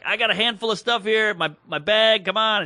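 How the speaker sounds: high, urgent begging; pleading. Retold or imitated. Traveler begging to get back in line, pleading. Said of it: "I'm begging her".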